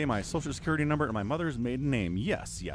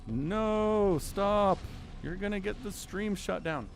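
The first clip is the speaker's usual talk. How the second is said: higher, fussy alarmed chatters; imitating worried viewers, pitched up; mock panicked chat; 6 semitones higher, slower than usual. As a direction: play the nervous chat begging him to stop. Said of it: "everyone in chat's like".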